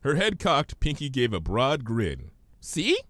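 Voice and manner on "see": much higher, bubbly pony; Pinky's voice. Acted. Pinky puzzled by Twilight's big word, high voice.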